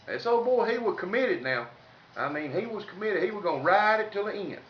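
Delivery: ordinary delivery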